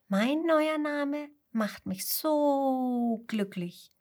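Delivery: slow, dreamy happy sheep voice; blissful sheep. A narrator's reading. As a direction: savour the joy of being loved. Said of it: "ruft das Schaf namens Geliebt". (langsam, verträumt glückliches Schaf)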